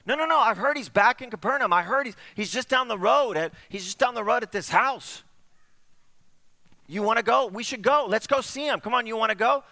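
high, loud, eager pleading friend; excited urging. Acted. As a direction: talk him into going. Friend urges him to go.

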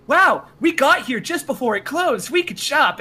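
mocking excited customer; delighted shopper. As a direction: gush like a clueless late customer.

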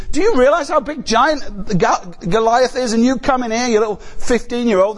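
a hint of a scoffing older brother; scornful older brother. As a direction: belittle the kid, incredulous. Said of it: "Eliab's thinking".

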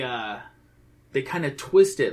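usual speaking voice

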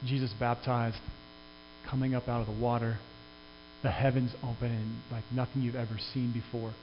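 a pastor softly walking listeners into the riverbank scene; hushed guided imagining. Acted. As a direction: draw the congregation into the picture, hushed. Can you imagine being there?.

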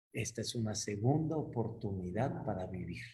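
low, soft, awed murmur; hushed realisation. Acted. Man waking from nightmare to his wife, hushed relieved realisation.

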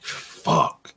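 a private, whispered "fuck"; whispered curse. Retold or imitated. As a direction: curse under his breath, voiceless. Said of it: "I'm like".

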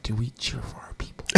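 put-on whispered kid's question; whispering. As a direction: whisper it like a confused child. Kids' quiet question retold.

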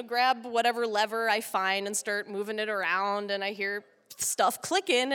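normal talking voice

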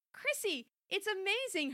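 lightly voiced yelling mom; mild narrated yell. A narrator's reading. Mom yells to wake her daughter, mild high-pitched yell. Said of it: "her mom was yelling".

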